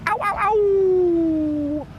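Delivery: long high wolf howl; werewolf howl. Pretend. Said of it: "my best Wolf impression".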